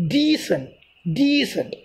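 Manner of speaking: decent as DEE-sent, DEE stressed; stress on first syllable. Shown as a demonstration. Teacher modelling word stress for students, Decent stressed on DE-. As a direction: hit the D syllable of "Decent", falling after. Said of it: "D is pronounced stressly".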